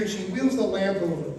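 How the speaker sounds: ordinary talk, nothing marked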